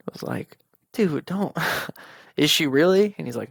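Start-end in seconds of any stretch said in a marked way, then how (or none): 0.9-1.6 higher, begging him to stop; a pained, pleading stop-it; cringing plea to a joking brother; pleading dude, don't. Retold or imitated. 2.4-3.1 louder, higher shocked question; jolted into alarm; shocked question to the joking brother; alarmed disbelief. Retold or imitated. Reacting to pregnancy joke.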